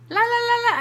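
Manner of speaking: high, sing-song drowning-out chant; fingers-in-ears babble; mock not listening. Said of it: "I'm not listening".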